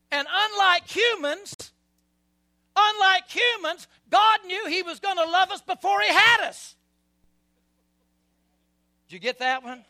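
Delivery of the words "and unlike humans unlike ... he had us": loud, high preaching build; rising fervour, hammering each I will not; climbing, roaring pulpit declaration; preaching at full force. Real, not acted.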